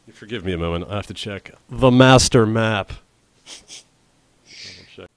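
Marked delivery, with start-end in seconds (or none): 3.5-5.1 whispered. Real, not acted. Muttered aside.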